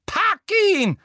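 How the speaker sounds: very high, loud shrieked order; piercing frantic cry; screaming Umbridge. A narrator's reading. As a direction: scream the command at your helper. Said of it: "schrie Umbridge".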